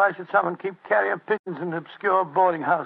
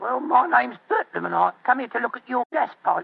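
put-on Cockney accent; 3 semitones higher than usual. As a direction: bluff it out in Cockney. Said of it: "My Cockney accent".

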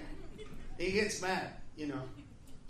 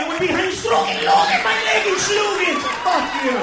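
loud, high shouting tirade; angry rant; 5 semitones higher, 12 dB louder than usual. Retold or imitated. Comic berating the French waiter, loud angry shouting. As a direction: yell him down with mock German.

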